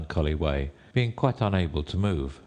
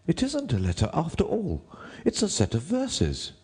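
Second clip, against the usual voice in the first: high, deferential courtier; 11 semitones higher than usual. A narrator's reading. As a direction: address the king in a high rabbit voice. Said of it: "and added".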